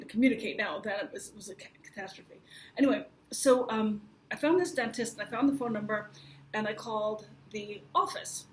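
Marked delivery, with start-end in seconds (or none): none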